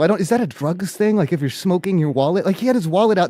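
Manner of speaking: ordinary delivery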